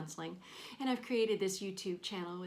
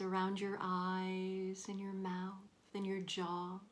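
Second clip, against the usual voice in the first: guided meditation. Real, not acted. Counselor leading listeners through a body scan, calm guided meditation. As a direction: guide them gently through breath and body, leaving space between phrases.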